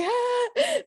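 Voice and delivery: high excited yelp like a delighted dog; happy dog imitation. Speaker imitating dogs thrilled to see their owner, high happy cry. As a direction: greet them like an overjoyed dog. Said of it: "they're so happy".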